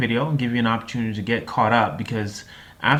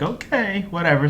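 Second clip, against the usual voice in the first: unimpressed; 8 semitones higher than usual. Retold or imitated. Wife unimpressed by gun gift.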